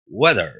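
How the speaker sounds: low "Weather" opening like Spanish "huevo". Shown as a demonstration. Teacher modelling the W sound, like huevo. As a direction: round the W of "Weather", weak final vowel. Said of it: "Si querés decir bien weather".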